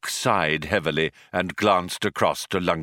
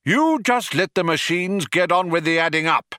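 higher-pitched, mildly indignant caution; warning; 9 semitones higher than usual. A narrator's reading. Philosopher warns programmers about machines, mildly indignant warning. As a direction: warn them off your territory. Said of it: "warned Magic Thighs".

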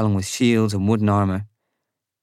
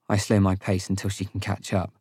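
usual speaking voice

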